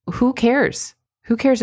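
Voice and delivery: sharp high "who" brushing it off; dismissive. Real, not acted. Coach scoffing at hedging. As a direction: toss it away.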